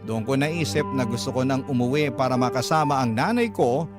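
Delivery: ordinary talk, nothing marked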